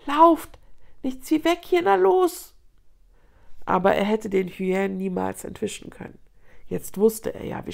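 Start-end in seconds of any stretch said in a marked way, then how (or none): 0.0-2.5 high, urgent plea to flee; urgent cry. A narrator's reading. Young Nuru begging his own paws to run, urgent cry. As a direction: urge frantically, pitched high. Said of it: "Vergeblich rief Nuru seinen Pfoten zu".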